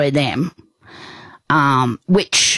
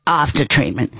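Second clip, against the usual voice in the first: ordinary talk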